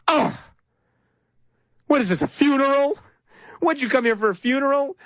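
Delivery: put-on high-pitched, exasperated teen huff; mock a petulant teenager's indignant questioning; Gary Johnson. Host mimics candidate berating the audience, teenage exasperation. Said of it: "acting like a teenager".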